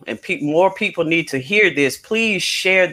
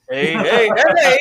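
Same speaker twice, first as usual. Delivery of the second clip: Fat Albert hey hey hey; 6 semitones higher, 4 dB louder than usual. Retold or imitated. Repeats the catchphrase.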